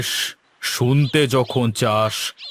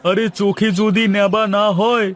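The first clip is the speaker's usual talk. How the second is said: sarcastic jab; 9 semitones higher than usual. Acted. Grocer mocks the servant asking for a wooden doll. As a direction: needle him for his foolishness.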